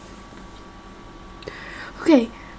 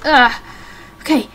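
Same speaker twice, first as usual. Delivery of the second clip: usual speaking voice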